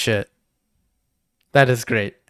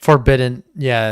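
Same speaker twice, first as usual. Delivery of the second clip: normal talking voice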